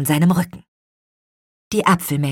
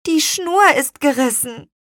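put-on high child voice explaining; high, contrite little-girl voice; apologetic girl; 10 semitones higher, 5 dB louder than usual. A narrator's reading. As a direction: explain sheepishly, pitched up.

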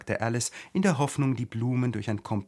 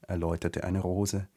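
plain reading voice

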